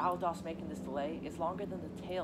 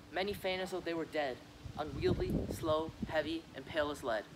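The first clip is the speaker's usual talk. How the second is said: ordinary talk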